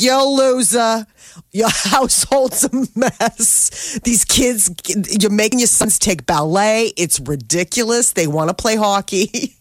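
a lightly voiced brash East Coast hockey mom; imitating an East Coast accent. Hockey mom mocking the goth dad, East Coast accent. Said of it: "she's got this amazing accent".

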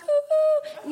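high teasing ooh sounds; high mock ooh. Comic teases the hugging cop with high oohs. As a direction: coo a high, mocking ooh.

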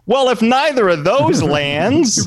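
high, loud, slow exasperation; exasperated protest. Retold or imitated. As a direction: plead in mock outrage. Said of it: "And I said".

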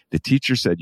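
ordinary delivery

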